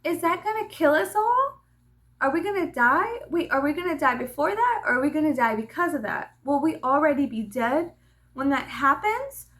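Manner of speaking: put-on high, loud, rushed child's questions; excited child. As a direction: imitate a curly-haired girl firing off questions. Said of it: "he was like".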